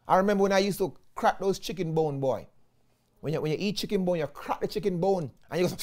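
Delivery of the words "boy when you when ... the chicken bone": ordinary delivery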